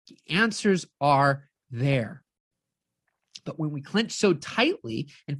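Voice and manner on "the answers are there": "The answers are there" said slowly, lower and drawn out; weighty slow repeat of "The answers are there". Shown as a demonstration.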